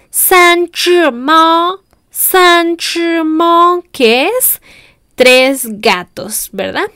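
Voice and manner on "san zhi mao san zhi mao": "san zhi mao" stretched out very slowly, syllable by syllable; very slow sentence demo. Shown as a demonstration. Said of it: "la segunda oración".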